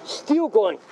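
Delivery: normal talking voice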